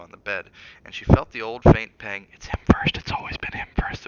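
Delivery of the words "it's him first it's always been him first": a bitter thought, whispered; inner thought whisper. Acted. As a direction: breathe the thought out unvoiced.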